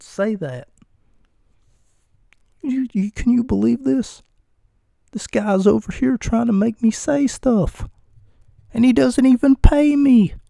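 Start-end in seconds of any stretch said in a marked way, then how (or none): 2.6-10.3 higher, hammed-up outrage; mock indignation. Host to listeners about the off-camera guy, mock indignant and higher. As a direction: whine in mock protest, pitched way up.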